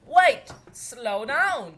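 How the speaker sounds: loud, very slow pleading call; cry out. A narrator's reading. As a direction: call after the fleeing scientist. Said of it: "cried Nelly".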